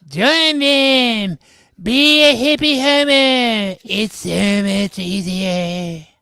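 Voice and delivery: high, loud seductive ring voice; eerie tempting voice. Acted. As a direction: tempt him like a cursed ring. Ring whispers tempting Frank.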